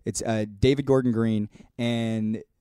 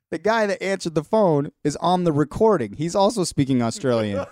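laughing; 5 semitones higher, 3 dB louder than usual. Retold or imitated. Laughing retelling of drunk friend.

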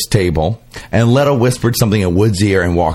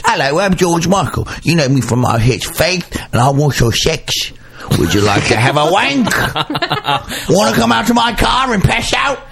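lightly put-on sleazy pop star, rising at the end; a pop star hitting on you, hammed up; 6 semitones higher than usual. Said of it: "When [name] says it, it's like this".

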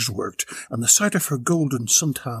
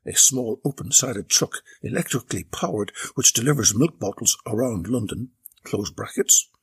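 usual speaking voice

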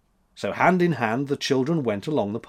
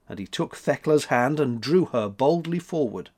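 ordinary delivery

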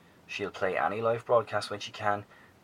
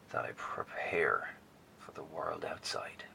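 slow, soft whisper, almost to himself; hushed whisper; 3 semitones lower, 5 dB quieter, slower than usual. Acted. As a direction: breathe the words out quietly as he resolves to leave. Professor's solemn departure farewell.

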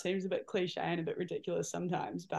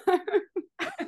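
high rising burst of laughter; 12 semitones higher, 5 dB louder than usual. Real, not acted. Host laughing at teammate's substitution mix-up, high laugh. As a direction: crack up at a friend's blunder.

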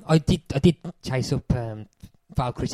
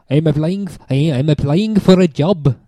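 mock Tim Roth accent; 3 semitones higher, 12 dB louder than usual. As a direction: ham up a terrible accent, loud and deliberate. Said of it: "That's probably the best accent I'll ever do".

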